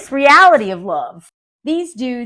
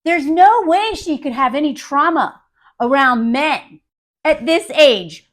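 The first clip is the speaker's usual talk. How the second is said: ordinary talk, nothing marked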